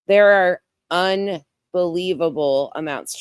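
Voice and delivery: ordinary talk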